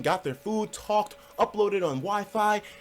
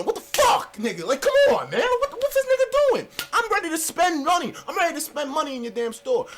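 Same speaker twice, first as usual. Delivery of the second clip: much higher, louder outburst; angry high shout; 9 semitones higher, 4 dB louder than usual. Retold or imitated. At the owner refusing service, an angry high shout. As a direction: shout your frustration at the locked door. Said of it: "I started to, like".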